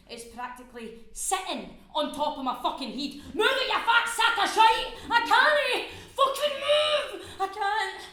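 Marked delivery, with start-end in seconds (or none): 3.3-4.9 high, very loud angry order; screaming abuse. Acted. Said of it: "screaming at me". 5.1-8.0 slow, high, drawn-out wail; panicked scream. Acted. Frozen climber screams back in panic.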